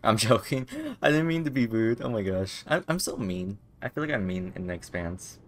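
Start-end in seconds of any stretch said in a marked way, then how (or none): none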